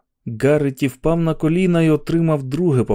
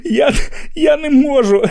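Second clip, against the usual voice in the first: begging between fits of laughter; high laughing plea; hysterical laughter; 13 semitones higher than usual. Acted.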